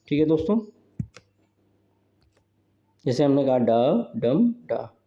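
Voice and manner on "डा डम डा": "डा डम डा" in slow, separate beats, middle one stressed; rhythm demo. Shown as a demonstration. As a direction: tap out "डा डम डा" slowly, stressing "डम". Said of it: "यानी अनस्ट्रेस्ड, स्ट्रेस्ड और अनस्ट्रेस्ड".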